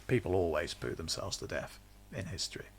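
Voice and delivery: a conspiratorial whisper; whispered aside. Real, not acted. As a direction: let it slip as a secret joke. Muttered aside about historic deaths.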